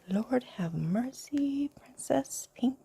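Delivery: hushed show-and-tell whisper; whispered ASMR. Real, not acted. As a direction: breathe the words close to the mic. Whispered unboxing commentary.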